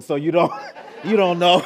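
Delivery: real laughter breaking into the words; laughing. Real, not acted. Laughing at own accent attempt.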